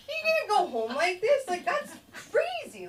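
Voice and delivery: high-pitched mock alarm; hammed-up worried coworker; alarmed reaction. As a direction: pitch it up in comic disbelief.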